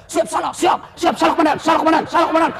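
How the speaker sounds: high barked yes-sir replies to a shouting commander; scolded policeman. Retold or imitated. A policeman scolded by his commander, barking high yes-sir replies. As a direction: snap out each siap salah like a cornered recruit. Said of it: "dimarah-marahi sama komandannya".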